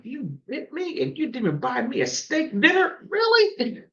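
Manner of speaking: high-pitched indignant telling-off, half joking; mock angry scolding. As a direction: scold the dog in mock outrage, pitch jumping on Really. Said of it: "getting very angry".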